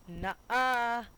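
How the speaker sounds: hammed-up bratty nuh-uh; defiant refusal. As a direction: blurt a cartoonish, cheeky no. Acts out the defiant reply.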